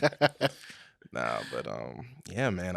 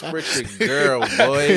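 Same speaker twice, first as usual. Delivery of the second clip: hype shout; 4 semitones higher, 12 dB louder, much slower than usual. Real, not acted. Hyping up the guest's alias.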